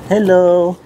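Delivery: high cooing hello; high pet greeting. Real, not acted. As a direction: greet the animal sweetly. Greeting the animals.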